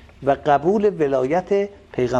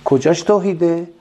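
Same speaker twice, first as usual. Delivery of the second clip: rhetorical question; 5 semitones higher, 7 dB louder than usual. Real, not acted.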